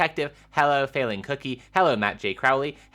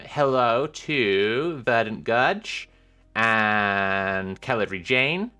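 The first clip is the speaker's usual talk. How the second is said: slow drawn greetings; 3 semitones lower, much slower than usual. Acted.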